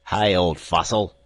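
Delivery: loud, mocking seaman's shout; jeering sailor's yell; rough sailor crying out. A narrator's reading. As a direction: heckle the old man. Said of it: "cried the man".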